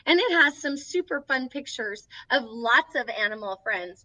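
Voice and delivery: plain reading voice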